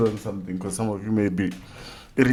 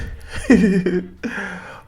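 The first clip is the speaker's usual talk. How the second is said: laugh; 9 semitones higher, 4 dB louder than usual. Real, not acted. Laughing at own swollen lip.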